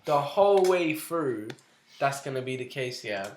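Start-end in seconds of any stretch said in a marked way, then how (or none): 0.0-1.5 slow, loud, drawn-out stress; loud drawn-out emphasis. Real, not acted. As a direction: stretch the words out big and loud. Laughing mockery of the match.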